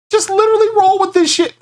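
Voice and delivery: squeaky high-pitched taunt; high mocking voice. Real, not acted. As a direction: pitch way up to mock him. Streamer mocks sensitive guest.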